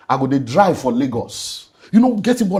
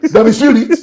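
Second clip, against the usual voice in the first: laughing; 13 semitones higher, 9 dB louder than usual. Real, not acted. Laughing at cooking for absent family.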